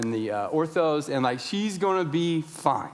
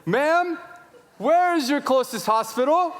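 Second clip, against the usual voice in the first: high, raised, half-sung plea; sing-song louder repeat; 10 semitones higher, 4 dB louder than usual. Retold or imitated. Frantic father to restaurant employee, sing-song and louder. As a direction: repeat it high and insistent, almost singing. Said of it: "I didn't sing it then".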